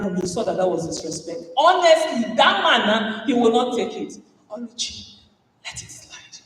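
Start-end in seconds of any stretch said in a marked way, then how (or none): 4.5-6.4 soft whispered calming; quiet self-talk. Retold or imitated. Speaker calming herself in the bathroom, whispered. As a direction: whisper to yourself to let go. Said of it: "I breathe and I say".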